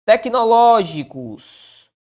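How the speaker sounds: "Tecnológicos" slow and loud, swelling on "ló"; stretched tonic syllable. Shown as a demonstration. Teacher sounding out "Tecnológicos" for its stress rule, drawn-out. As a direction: hold the "ló" in "Tecnológicos". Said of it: "Pró-paroxítona".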